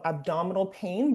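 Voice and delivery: usual speaking voice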